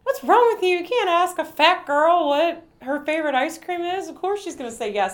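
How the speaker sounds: high scolding imitation of mom. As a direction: tell her off in a high mom voice. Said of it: "she's like".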